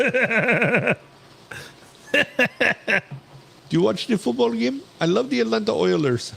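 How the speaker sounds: put-on high-pitched Indian accent; mock call-center Indian accent. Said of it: "in an Indian accent".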